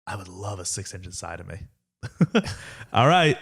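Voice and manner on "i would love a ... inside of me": much softer, relishing deadpan; quiet repeat. Shown as a demonstration. Friend repeats Burke's best that's-what-she-said line, softly. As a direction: savor the punchline quietly. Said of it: "That's what she said".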